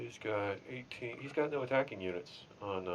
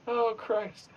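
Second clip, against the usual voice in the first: mock despair; 14 semitones higher, 7 dB louder than usual. Despair at dice.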